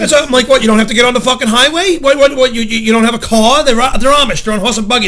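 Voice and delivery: reenacted loud, exasperated road-rage tirade; yelled rant. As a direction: fire off incredulous, indignant questions. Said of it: "I yelled and screamed".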